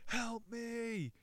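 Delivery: desperate high shout into the night; a lost climber screaming for his friend in the dark; shouting for help. Acted. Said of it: "Joe shouts into the night".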